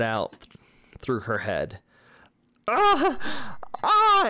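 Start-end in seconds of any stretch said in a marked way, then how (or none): 2.7-4.3 piercing high shrieks of agony; screaming in pain. Acted. Vanya screaming as blue lines spread through head. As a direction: scream as if your head is splitting. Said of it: "she's just screaming in pain".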